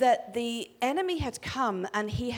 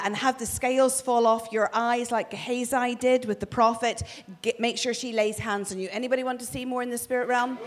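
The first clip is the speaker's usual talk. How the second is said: normal talking voice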